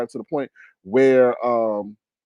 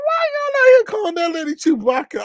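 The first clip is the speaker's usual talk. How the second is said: much higher, loud, half-crying laughing yell; incredulous shriek; 4 dB louder than usual. Retold or imitated. Host shrieking at chat over a Chewbacca nickname, incredulous. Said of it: "I'm like".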